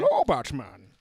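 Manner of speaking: loud muffled villain greeting; Bane impression. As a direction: deliver it like Bane. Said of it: "Bane quote".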